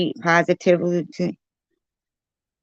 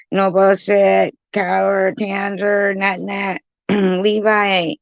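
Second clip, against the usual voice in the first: ordinary delivery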